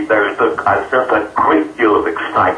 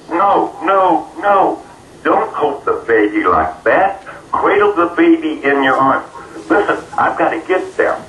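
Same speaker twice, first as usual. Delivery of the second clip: higher-pitched, insistent retold plea; urgent protest; 7 semitones higher than usual. Abductee stops woman dangling the baby, mildly urgent protest. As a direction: plead with a touch of alarm.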